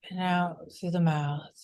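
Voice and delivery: unhurried breath cues with long pauses; calm meditation leader, increasingly hushed; guided meditation. Real, not acted. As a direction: guide the group gently through each breath, softening as it settles.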